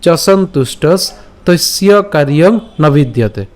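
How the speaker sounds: slow, projected Sanskrit recitation; verse line repeated. Shown as a demonstration. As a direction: recite the line firmly, phrase by phrase. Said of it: "তাহলে আমি আবার যদি বলি".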